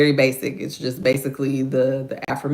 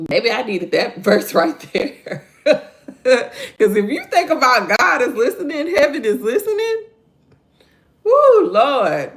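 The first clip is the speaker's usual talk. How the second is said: laughing, higher and louder; 9 semitones higher, 6 dB louder than usual. Real, not acted. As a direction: laugh at yourself while you preach. Laughing at herself.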